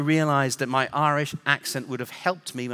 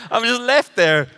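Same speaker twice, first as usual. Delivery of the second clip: laughing; 7 semitones higher, 8 dB louder than usual. Real, not acted.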